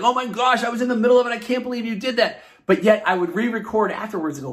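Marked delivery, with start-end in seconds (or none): 0.0-2.4 pitched high, mock exasperation at being interrupted; exasperated outburst. Host recalling his old rants at interruptions, exasperated and higher. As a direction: replay the old frustrated rant, higher.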